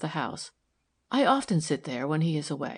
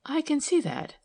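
higher-pitched, brief acknowledgement; a brief answer, pitched up; brief reply; 9 semitones higher than usual. A narrator's reading. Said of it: "said Milly briefly".

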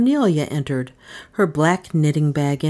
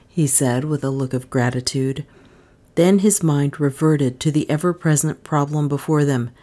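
usual speaking voice

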